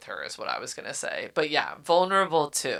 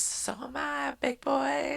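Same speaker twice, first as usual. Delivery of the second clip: sultry flirt; 10 semitones higher, 5 dB quieter than usual. Retold or imitated. Heroine's flirty comeback voiced.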